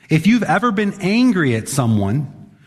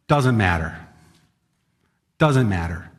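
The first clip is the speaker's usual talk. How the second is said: low, final fatherly ruling; firm dismissal; 6 semitones lower than usual. Acted. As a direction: shut down the excuse with a low, firm voice. Acted father cutting off child's excuse.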